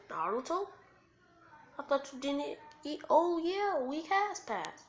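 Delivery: high-pitched, surprised ramen seller; lifted, questioning character voice. Acted.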